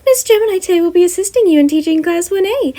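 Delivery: high, bright-voiced principal; Nezu voice. Nezu asking Gem about housing, high character voice. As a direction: lift the pitch way up for the chimera principal.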